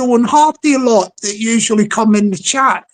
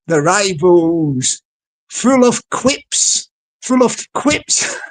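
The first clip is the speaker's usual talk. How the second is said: slow dramatic announcer; 4 semitones lower, slower than usual. Acted.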